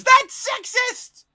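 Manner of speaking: high, loud outraged shriek; mock scream. Vlogger imitating someone objecting to his joke, mock scream. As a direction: scream it like an offended heckler. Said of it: "before anybody screams".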